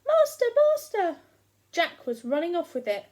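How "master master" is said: high, loud cry for help; harp calling. Acted. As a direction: shriek like a stolen harp. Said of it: "my harp is calling".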